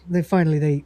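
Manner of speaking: ordinary talk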